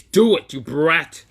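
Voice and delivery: high, loud furious order; furious shout. Acted. Naruto ordering his son while choking him, furious. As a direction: bark it at the boy. Naruto grabs son's throat.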